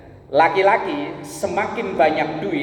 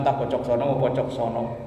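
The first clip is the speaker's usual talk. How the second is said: ordinary delivery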